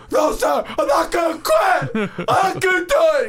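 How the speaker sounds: high, loud, desperate drill-sergeant-style yell; shouting cadet. Retold or imitated. Host reenacting the cadet defying his drill instructor with a loud shout. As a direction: scream it like a cadet refusing to quit. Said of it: "He's like".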